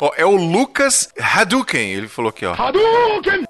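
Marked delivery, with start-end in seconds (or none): 2.5-3.4 high, loud attack yell; grito de luta. Pretend. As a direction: shout it like a fighting game special move. Grito de golpe de videogame. (grito de golpe de luta)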